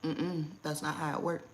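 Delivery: ordinary talk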